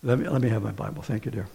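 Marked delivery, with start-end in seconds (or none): none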